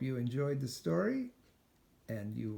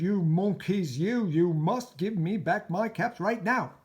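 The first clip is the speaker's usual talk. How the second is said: high, very loud furious shout; 9 semitones higher, 11 dB louder than usual. Acted. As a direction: stamp both feet and yell. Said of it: "stamped both his feet and shouted".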